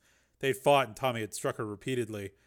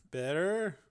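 slightly nervous, higher voice; a touch of nerves through swollen lips; a bit nervous; 5 semitones higher than usual. A narrator's reading. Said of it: "a bit nervously, through swollen lips".

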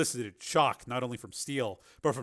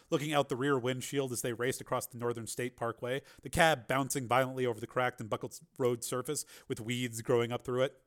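usual speaking voice

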